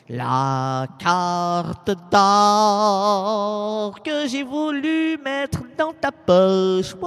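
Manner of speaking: slow, loud, drawn-out declaiming; dramatic declamation. Acted. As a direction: stretch out la carte d'or, loud and grand. Déclame le poème. (déclamation lente et forte)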